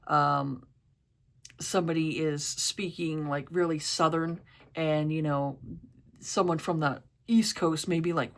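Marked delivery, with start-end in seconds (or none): none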